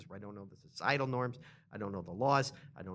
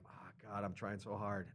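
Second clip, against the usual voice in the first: much quieter, low weary plea; struggling learner; 5 semitones lower, 12 dB quieter than usual. Retold or imitated. Learner voicing his own struggle, soft and low. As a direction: drop to a soft, low exasperated aside. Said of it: "'cause I'm like".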